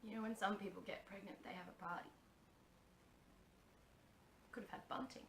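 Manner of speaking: very soft, fading to whisper; wistful murmur. Acted. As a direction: murmur it sadly to yourself.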